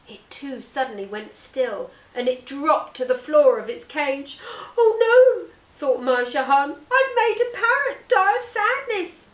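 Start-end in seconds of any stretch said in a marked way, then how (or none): 4.7-9.3 high, loud cry of guilt and shock; horrified dismay. Acted. Merchant sees her parrot fall, high anguished cry. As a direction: wail at what she has done.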